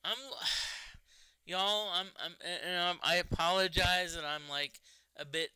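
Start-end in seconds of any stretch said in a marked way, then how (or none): 0.2-1.0 heavy, voiceless exhale; exasperated sigh. Real, not acted. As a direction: sigh out the frustration mid-sentence. Exasperated, apologizing to viewers.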